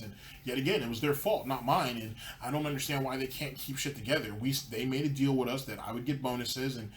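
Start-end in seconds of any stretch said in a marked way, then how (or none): none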